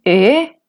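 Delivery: rising-tone "e". Shown as a demonstration. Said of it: "el tono ascendente".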